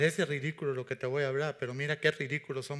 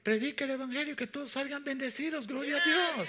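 fiery preaching; 10 semitones higher than usual. Real, not acted. Preaching at full force.

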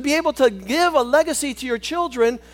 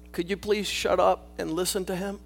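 normal talking voice

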